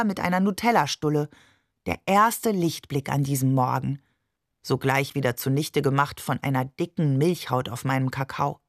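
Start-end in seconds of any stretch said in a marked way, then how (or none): none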